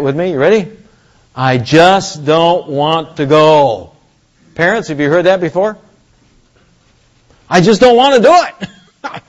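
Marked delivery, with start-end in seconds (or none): 1.3-3.9 slow, drawn-out whine of refusal; mock-whiny, slow and stretched; childish reluctance. Voicing Moses as a reluctant child. 7.5-9.2 high, mock-whiny kid protest with a laugh; hammed-up high-pitched balking; childish refusal. Parents' familiar whining child, acted.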